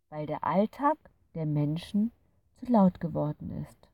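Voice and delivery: a gentle creature sharing a secret truth; wise fairy. A narrator's reading. Fairy explains its mission. (weise, freundliche Fee erklärt sanft)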